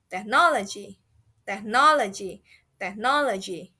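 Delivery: "technology" with NO stressed; stress on middle syllable. Shown as a demonstration. Teacher drills learners, "technology" stressed on NO. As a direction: lean hard on NO in "technology". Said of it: "middle emphasis on the middle syllable".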